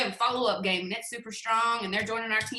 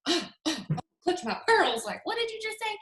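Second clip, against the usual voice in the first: shocked; 9 semitones higher, slower than usual. Retold or imitated. Shocked prospect, gasp.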